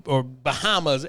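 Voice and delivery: ordinary talk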